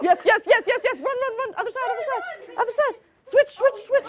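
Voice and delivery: high, loud game-host shouts; rapid-fire excited cues, pitched high; excited calling out. Real, not acted. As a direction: fire off repeated calls urgently. Host calls out in timed game.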